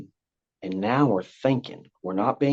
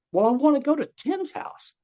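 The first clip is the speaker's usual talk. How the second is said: put-on exasperated child. Child voiced repeating his wish.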